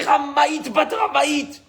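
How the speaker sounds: high-pitched, shouted insult; heated accusation. Retold or imitated. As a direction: call it out high like an outraged accuser. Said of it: "ça a été chaud". (invective criée, voix haut perchée)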